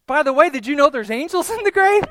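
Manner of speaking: loud, high, incredulous question; incredulous Mary. Acted. Mary tells the gardener about angels in the grave, incredulous. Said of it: "And so she says".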